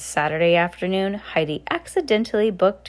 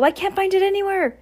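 panicked; 13 semitones higher, faster than usual. A narrator's reading. Panicked girl lost planner.

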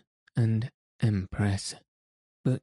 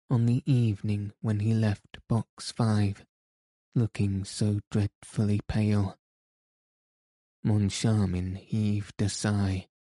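ordinary delivery, nothing marked

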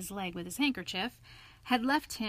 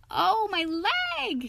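high, loud, drawn-out wail; slow yelp of a hurt animal; pained cry; 11 semitones higher, 6 dB louder, much slower than usual. A narrator's reading. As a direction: cry out in sudden pain. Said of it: "he cried".

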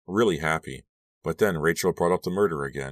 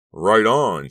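loud, higher cheering shout; 5 semitones higher, 9 dB louder than usual. A narrator's reading. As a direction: shout approval across to another table. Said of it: "shouted some jocks".

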